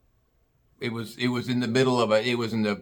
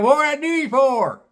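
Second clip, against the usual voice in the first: country bumpkin greeting; 13 semitones higher, 4 dB louder than usual. Retold or imitated. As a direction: chirp it like a toothless country bumpkin. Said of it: "out walks this bumpkin".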